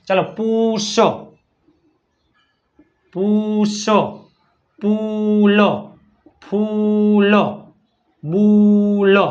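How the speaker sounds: પૂછ, પૂલ, ફૂલ, બુલ stretched slowly, word by word; teaching the u vowel. Shown as a demonstration. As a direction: model પૂછ, પૂલ, ફૂલ, બુલ with a held "oo" and separate last consonant. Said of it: "ટૂંકો ઉ છે". (પૂછ, પૂલ, ફૂલ, બુલ ધીમે, છૂટા પાડીને)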